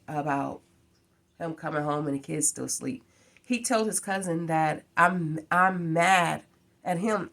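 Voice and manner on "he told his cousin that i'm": normal talking voice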